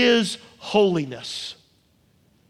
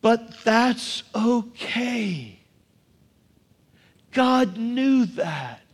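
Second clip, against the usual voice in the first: gentle reassurance; much slower than usual. Acted. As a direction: ease them down slowly, tenderly. Turning to comfort after sin.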